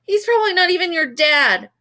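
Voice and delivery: high, loud angry jab; angry screech. A narrator's reading. Kaya insulting David, high loud angry jab. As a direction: spit the insult out.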